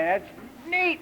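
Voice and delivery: lightly voiced high admiring coo; a hint of a doting grandparent; admiring grandma. Grandma praising Farquhar's trick, high admiring coo.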